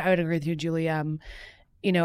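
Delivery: ordinary delivery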